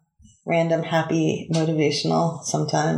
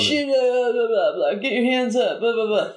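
hurried mock cop orders, slightly higher; lightly voiced flustered cop; 4 semitones higher than usual. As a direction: bark at nobody, half-hearted. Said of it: "And you're like".

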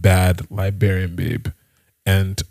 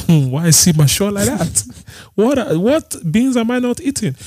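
laughing; 11 semitones higher than usual. Real, not acted. Laughing at own story.

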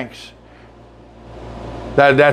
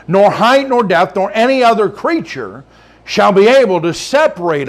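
raised, ringing preacher's cadence, higher; full-force preaching; 5 semitones higher than usual. Acted. Pastor preaching to his church, full-force and ringing. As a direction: preach it out to the congregation.

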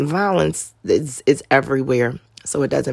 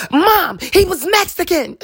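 daughter's answer. Retold or imitated. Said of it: "I said".

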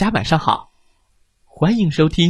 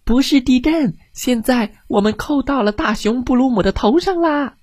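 shouting little fish; 9 semitones higher than usual. Acted. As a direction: holler it like a tiny excited fish. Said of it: "大声地嚷嚷着".

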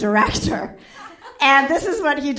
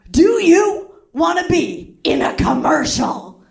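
slow, louder, higher pitchman voice; hammed-up pitchman offer; smooth showman; 6 semitones higher, 5 dB louder, slower than usual. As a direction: sell it like a showman. Fancy Ray pitching commercial.

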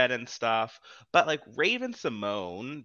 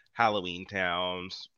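ordinary delivery

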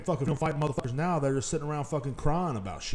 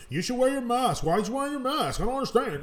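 much higher mocking voice of nagging housemates; 11 semitones higher than usual. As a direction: mimic the scolding family, pitched up high. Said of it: "Everybody in the household ... was like".

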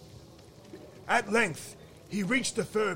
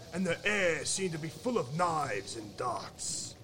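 ordinary delivery

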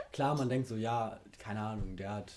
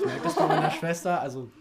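laughing loudly while talking; 13 dB louder than usual. Real, not acted. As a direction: break into loud laughter. Laughs about sister.

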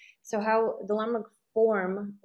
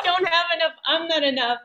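laughing; 8 semitones higher, 5 dB louder than usual. Retold or imitated. Echoing inner voice, laughing.

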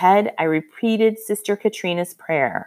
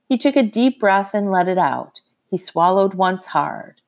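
usual speaking voice

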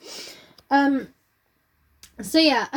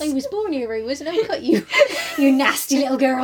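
laughing London hard-man threat; hammed-up cockney menace; mock cockney tough; 3 dB louder than usual. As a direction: play the cockney thug for laughs. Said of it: "Joss Merlin cockney".

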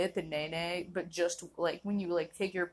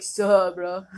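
much louder, tossed-off bro greeting; casual bro greeting; 11 dB louder than usual. Retold or imitated. Greeting a friend on reflex, loud casual bro. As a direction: bark a chill greeting. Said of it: "I'll be like".

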